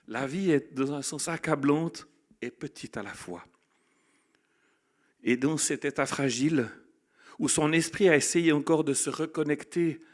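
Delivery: loud, building sermon storytelling; impassioned preaching. Acted. Pastor recounting the astronaut's return to the congregation, loud impassioned preaching. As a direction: tell the astronaut's story with full conviction.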